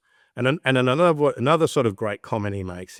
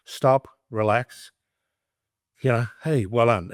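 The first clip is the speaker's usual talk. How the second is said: soothing a horse; 2 semitones higher than usual. Retold or imitated.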